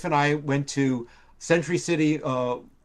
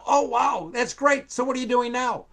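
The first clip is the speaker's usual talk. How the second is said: impressed; 10 semitones higher than usual. Retold or imitated. Spielberg impressed, retold.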